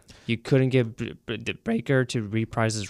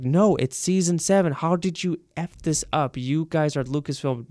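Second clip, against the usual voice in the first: higher-pitched exasperated telling-off; a touch of fan outrage, pitched up; mock indignation; 5 semitones higher than usual. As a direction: scold them for getting it wrong. Acted complaint to Lucasfilm.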